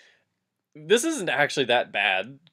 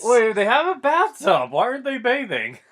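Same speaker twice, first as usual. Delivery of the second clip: surprised confusion; 11 semitones higher, 7 dB louder than usual. Real, not acted. Confused outburst.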